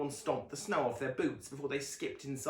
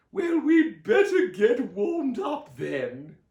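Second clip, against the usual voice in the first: high, loud, slow cheerful aunt; Aunt Summer rallies the family, slow loud grin; Aunt Summer grinning; 11 semitones higher, 12 dB louder, much slower than usual. A narrator's reading. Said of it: "noted with a grin".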